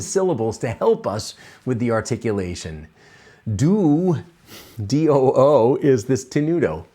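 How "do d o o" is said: "Do" held long for full value; sustained, unbroken "Do"; long syllable. Shown as a demonstration. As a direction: stretch out "Do", loud and high. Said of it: "it's long".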